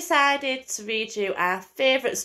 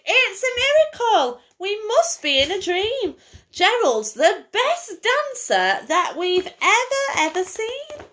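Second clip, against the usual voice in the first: excited shout; 11 semitones higher, slower than usual. Acted. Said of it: "They shouted".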